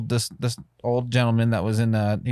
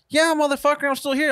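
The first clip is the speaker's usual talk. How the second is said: hammed-up exasperated snap, much higher; fed-up sarcasm pitched way up; mock angry retort; 13 semitones higher than usual. Waiting customer snaps back at the service guy, exasperated.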